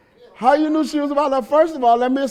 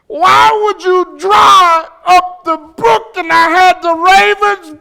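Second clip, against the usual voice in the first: complaining believer; 8 semitones higher, 6 dB louder, slower than usual. Retold or imitated.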